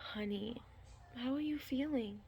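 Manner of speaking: a hint of the doctor's gentle concern; imitating the doctor. Doctor checks on her pregnant patient, lightly voiced soft concern.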